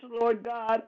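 normal talking voice

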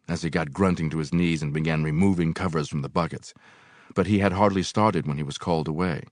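ordinary delivery

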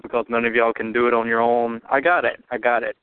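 normal talking voice

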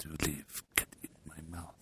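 barely voiced creepy whisper; very soft, low, sinister whispering of a movie line. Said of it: "It just sounds creepier when you do it".

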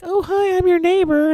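very high, sugary imitation of the neighbor; mock neighbor voice. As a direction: squeak it out like an overly sweet neighbor. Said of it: "And she's like".

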